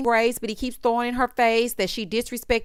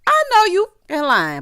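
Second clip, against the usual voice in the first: raised, higher sassy callout; louder and higher accusing quote; mock scolding; 8 semitones higher, 6 dB louder than usual. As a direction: call him out loud. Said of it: "And that's when I said".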